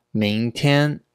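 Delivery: ordinary talk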